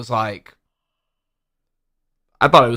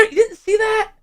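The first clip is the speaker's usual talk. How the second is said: much higher, incredulous question; can't believe he missed it; surprised; 4 dB quieter than usual. Real, not acted. Friend hasn't seen Skyfall.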